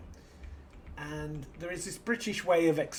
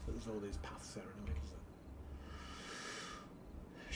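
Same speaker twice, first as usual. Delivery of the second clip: whispering; 5 semitones lower, 12 dB quieter, much faster than usual. Real, not acted. Muttering to self while playing.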